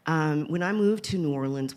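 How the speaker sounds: normal talking voice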